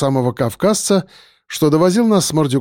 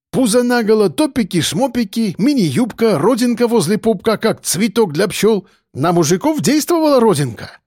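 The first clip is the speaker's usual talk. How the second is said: high-pitched, excitable listing; agitated character voice; animated Caucasian man; 7 semitones higher than usual. A narrator's reading. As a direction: list it vividly and disapprovingly. Akbar describes outfits.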